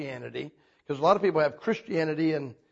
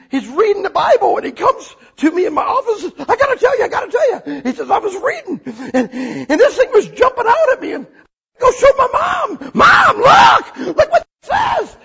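loud, much higher, laughing excitement; thrilled retelling; 13 semitones higher, 7 dB louder than usual. Retold or imitated. Preacher voicing Steve's thrill at the Bible, high and laughing. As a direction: bubble over with joy.

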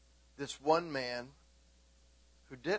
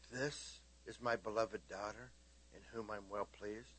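soft, gentle voice of a loving father; tender hush; 4 semitones lower, 12 dB quieter than usual. Acted. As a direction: hush it like a blessing. Tender soft hushed voicing.

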